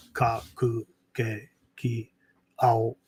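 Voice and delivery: "Ka, ku, ke, ki, ao" in Japanese pronunciation, from the back of the mouth; Japanese syllable drill. Shown as a demonstration. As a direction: practise "Ka, ku, ke, ki, ao" softly, top of the throat, lips and tongue barely used. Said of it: "speak from the back of their mouth".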